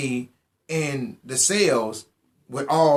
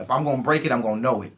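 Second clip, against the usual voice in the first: normal talking voice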